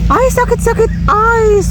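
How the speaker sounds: put-on high, sing-song toddler voice; baby talk echo. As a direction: echo the crying kid to soothe them. Comforting the child who cried. (malambing na boses-bata)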